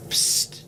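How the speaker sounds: unvoiced hiss; breathy ghost whisper; whispered psst.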